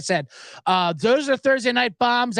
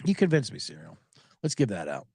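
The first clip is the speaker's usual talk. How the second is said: ordinary talk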